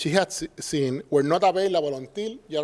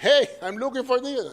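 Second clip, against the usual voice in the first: bright, higher call for help; perky customer call; 8 semitones higher than usual. Retold or imitated. To an ignoring shop clerk, a raised hey. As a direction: pipe up to get noticed. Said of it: "I'm like".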